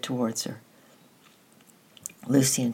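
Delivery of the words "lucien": commanding. A narrator's reading. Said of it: "she commanded".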